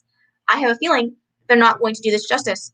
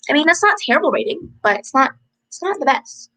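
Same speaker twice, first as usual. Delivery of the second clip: normal talking voice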